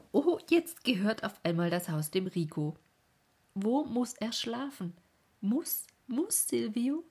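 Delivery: aufgeregt und hoch, wie ein jubelndes Kind. A narrator's reading. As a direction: ein kleiner Junge jubelt los. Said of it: "brach in Jubel aus und rief". (Silvio jubelt über die Neuigkeit, laut und hoch)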